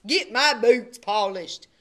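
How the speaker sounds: loud, slow demanding order; shouted order. A narrator's reading. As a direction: drag out the command. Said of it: "shouted Milton".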